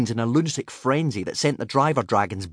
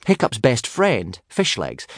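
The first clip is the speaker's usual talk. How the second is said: usual speaking voice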